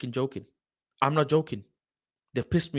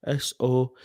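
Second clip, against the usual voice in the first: ordinary talk, nothing marked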